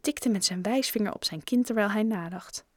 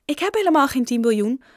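quick, loud, higher-pitched protest; 6 semitones higher, 6 dB louder, faster than usual. A narrator's reading. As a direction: object at once, fast and insistent. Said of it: "protesteerde Joe". (snel, luid protesterend)

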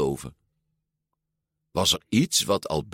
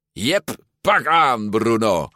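higher, drawn-out triumphant call; booming; 4 semitones higher, 4 dB louder, slower than usual. A narrator's reading. Alberto gloating after the jump, booming. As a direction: crow over a beaten foe. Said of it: "bulderde Alberto".

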